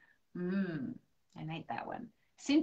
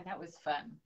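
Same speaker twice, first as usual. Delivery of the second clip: usual speaking voice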